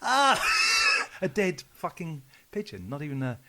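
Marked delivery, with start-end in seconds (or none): 0.0-1.2 loud, high cry of disgust; mock horrified cry. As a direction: cry out in comic horror. Reacts to shagging pigeon story.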